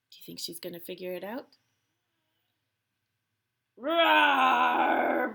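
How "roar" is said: long, loud, drawn-out roar; bear roar. A narrator's reading. Old Bear answers being told he eats like a bear, roaring. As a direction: let out a big sustained bear roar. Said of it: "roared Old Bear".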